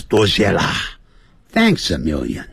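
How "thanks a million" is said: ordinary delivery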